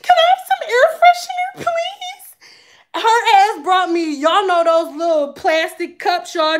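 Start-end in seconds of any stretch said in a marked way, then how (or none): 0.0-3.0 very high mock-tearful begging; crying plea. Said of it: "I started crying".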